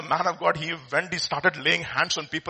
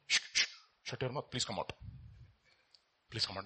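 soft hushing rebuke, much softer; quiet rebuke; 6 semitones lower, 14 dB quieter than usual. Retold or imitated. Preacher voicing Jesus quietly hushing a demon. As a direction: hush the demon under your breath. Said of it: "whenever Jesus went".

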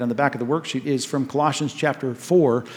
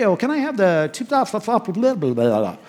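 lightly imitating a deliberate mumbler, pitch up at the start; mock mumbling; 4 semitones higher than usual. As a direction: rattle off half-slurred nonsense words as a joke. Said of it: "he was an expert mumbler".

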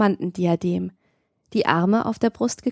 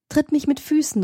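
higher-pitched, mildly exclaimed plea; called out; 6 semitones higher than usual. A narrator's reading. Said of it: "rief ich". (mit höherer Stimme ausgerufen)

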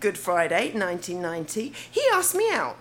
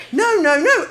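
louder, higher triple refusal; firm protest; 7 semitones higher, 6 dB louder than usual. Retold or imitated. Tipsy girl refusing the boy's advances, firm protest. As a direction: fend off an advance, raised voice.

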